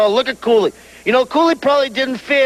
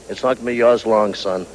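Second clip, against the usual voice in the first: low menacing threat; 12 semitones lower than usual. Acted. Manager warning the champion he'll lose the belt, low and menacing. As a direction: drop way down and growl the warning.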